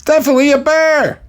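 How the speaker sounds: very high mock-panicked shriek; Gary. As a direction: insist on the danger in a hammed-up scream. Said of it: "Gary screams".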